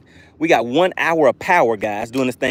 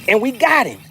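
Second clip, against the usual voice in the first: excited; 9 semitones higher than usual. Real, not acted. Excited catch on stream.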